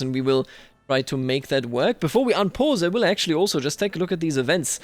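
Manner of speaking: ordinary talk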